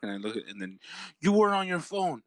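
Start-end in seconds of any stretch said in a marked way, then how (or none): 1.2-2.2 high, indignant mock yell; retold yelling. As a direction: accuse like an outraged driver. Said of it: "The Mexican lady's yelling".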